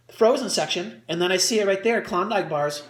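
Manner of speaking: ordinary talk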